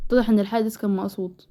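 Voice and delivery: ordinary talk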